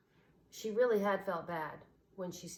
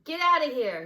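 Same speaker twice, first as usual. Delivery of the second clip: higher, much louder angry snap; snapping; 9 semitones higher, 12 dB louder than usual. A narrator's reading. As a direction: throw your sister out. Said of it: "he snapped at her".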